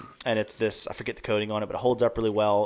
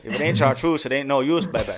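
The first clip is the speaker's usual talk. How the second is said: loud Cajun accent, higher; 4 semitones higher, 5 dB louder than usual. Shown as a demonstration. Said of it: "You gotta say it in your Cajun accent".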